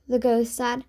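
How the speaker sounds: ordinary delivery, nothing marked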